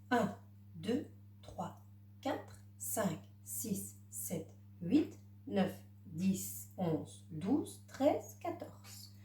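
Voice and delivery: counting papillons under the breath, softly. Acted. Teacher counting butterflies for the child, soft and murmured. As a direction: murmur the numbers one by one as you point.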